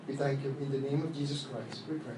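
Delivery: ordinary talk, nothing marked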